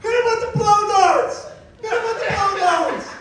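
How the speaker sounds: high, loud frantic yell; mock panicked order. Mitch begging zoo staff for blow darts, frantic yelling. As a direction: bark the order in desperation. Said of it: "Mitch turns around and says".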